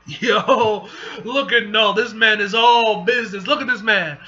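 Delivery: loud, delighted hype; hyped fan. Real, not acted. Reader admiring Null on the page, hyped. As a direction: gush loudly over the villain.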